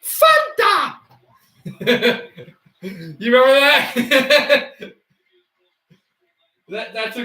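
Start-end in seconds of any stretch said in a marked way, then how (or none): 0.0-1.0 very high, slow, laughing "That's Fanta!"; high squeaky quote. Retold or imitated. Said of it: "and he goes". 1.7-4.9 laughing. Real, not acted. Laughing recalling the clip.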